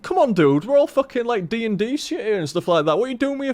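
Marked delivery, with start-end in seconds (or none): none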